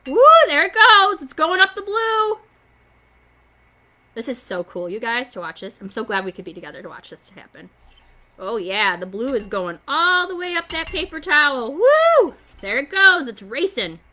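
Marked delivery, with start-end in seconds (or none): 0.1-2.4 high delighted whoop at the blue rising; excited. Real, not acted. Teacher cheering the blue water up the towel, excited. 9.9-13.3 excited. Real, not acted. Water racing up towel.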